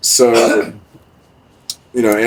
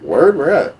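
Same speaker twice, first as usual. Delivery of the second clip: mock excited reply; 8 semitones higher, 4 dB louder than usual. Own excited reply, retold.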